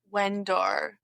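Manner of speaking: "window" said as "win-dor"; Aussie "windor" for "window"; R added. Shown as a demonstration. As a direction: end "window" on an R sound. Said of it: "O adds an R".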